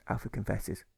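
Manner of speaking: ordinary delivery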